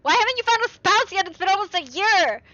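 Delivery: high, loud mock mother voice; shrill-free but pushy parody of mom nagging. Mocking mom nagging about spouse.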